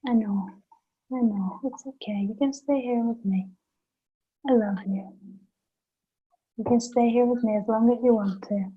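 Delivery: soft, hushed comfort; soothing a baby. Retold or imitated. Therapist voicing comfort to a screaming baby, hushed and tender. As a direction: cradle the words like a crying baby. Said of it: "we just hold it and say".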